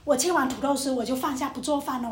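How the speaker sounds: ordinary talk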